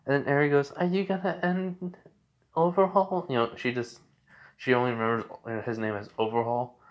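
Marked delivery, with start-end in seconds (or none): 0.7-3.3 put-on high, small child asking timidly; little girl voice. Eri asking Deku about Overhaul, small child voice. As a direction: lift into a little girl's voice.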